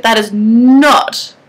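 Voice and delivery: very slow, firm stress on not; asserted. Acted. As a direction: drag out that is not insistently. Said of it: "I asserted".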